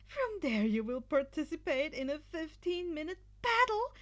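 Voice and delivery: high, loud announcer explaining rules with relish; thrilled host spelling out the rules; excited Midnight. A narrator's reading. Midnight explaining the cavalry battle rules to students, loud and gleeful. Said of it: "Midnight explained excitedly".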